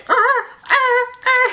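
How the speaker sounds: short high yelps; imitated transfer cries. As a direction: yelp in bursts as if zapped.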